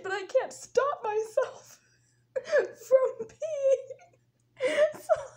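high, slow words choked by laughter; laughing. Real, not acted. Telling how she missed the cup, laughing. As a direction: laugh helplessly through the confession. Cracks up at the accident.

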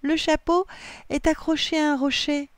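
high, excited announcement; excited cry. A narrator's reading. Assa tells cousins the hat is caught, excited cry. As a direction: share the find, pitched right up. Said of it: "s'écria ça".

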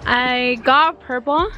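slight lisp from braces; talking around new brackets; speaking with braces. Real, not acted. Seen 0: Metal brackets clearly visible on her teeth while speaking, close-up.

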